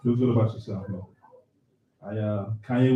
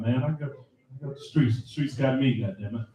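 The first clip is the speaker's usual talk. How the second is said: ordinary talk, nothing marked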